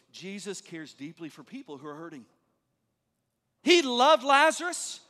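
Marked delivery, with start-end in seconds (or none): 0.1-2.3 hushed, low and heartfelt; tender sincerity. Real, not acted. Preacher telling congregation Jesus cares for the hurting, softly sincere. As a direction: drop right down, soft and caring. Tender point after lively mock quote.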